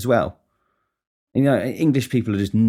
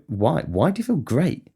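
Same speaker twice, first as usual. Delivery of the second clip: high, rising, incredulous questioning; suspicious interrogation; 6 semitones higher, slower than usual. Acted. Grilling someone who says they're great, incredulous questioning. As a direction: demand an explanation, pitch climbing sharply. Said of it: "followed by an interrogation".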